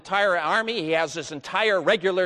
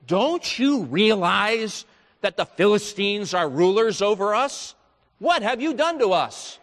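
louder, higher, accusing the hero; indignant protest; 5 semitones higher, 5 dB louder than usual. Acted. Men of Judah accusing Samson, an indignant louder cry. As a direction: demand it like an angry crowd.

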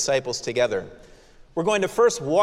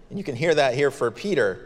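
ordinary talk

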